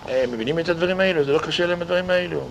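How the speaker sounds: usual speaking voice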